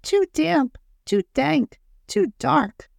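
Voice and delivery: very high, tiny squeaking mouse voice; squeaky mouse. A narrator's reading. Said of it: "Mouse squeaks".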